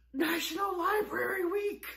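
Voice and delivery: excited. Real, not acted. Announcing the special week.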